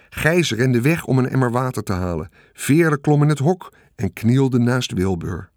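ordinary delivery, nothing marked

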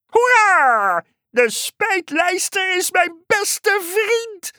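very high, stretched-out cheer; jubilant frog. A narrator's reading. Frog cheering its best friend, jubilant. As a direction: shout hooray, then drag the words out gleefully.

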